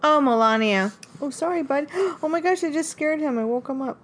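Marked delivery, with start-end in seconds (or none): none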